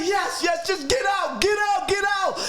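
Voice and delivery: very high, frantic plea; frightened man. Retold or imitated. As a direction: squeal the yeses in fear. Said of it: "He's like".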